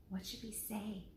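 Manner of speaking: soft whispered question. Acted. Annie whispers to Jack before he writes: soft whisper. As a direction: lean in and whisper. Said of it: "she whispered".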